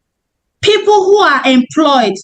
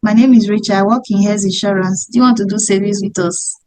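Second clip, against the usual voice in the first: lower, light sales pitch to a churchgoer; friendly greeting; 4 semitones lower, faster than usual. Shown as a demonstration. Saleswoman greeting a churchgoer after service, lower and friendly. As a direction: chat up a stranger, lower and quicker at the end. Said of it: "When I'm greeting".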